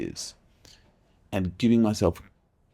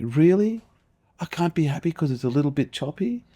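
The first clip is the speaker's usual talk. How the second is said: incredulous outrage; 9 semitones higher than usual. Retold or imitated. Arguing with his inner voice, high incredulous outrage. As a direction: scoff at the idea that choppy water ruins it. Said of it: "And I'm fucking furious. I'm like".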